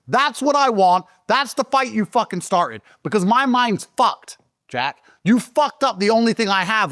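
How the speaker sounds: high, slowed, impassioned tirade, hurling every "you" at them; furious defiance. Retold or imitated. Telling his enemies they started the fight, in a high furious rant. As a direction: rage it out like a man with nothing left to lose.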